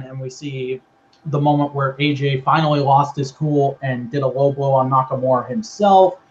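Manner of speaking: normal talking voice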